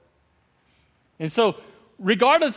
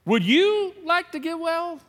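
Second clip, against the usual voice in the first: Jesus asking; 7 semitones higher, slower than usual. Retold or imitated. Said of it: "Jesus asked him this question".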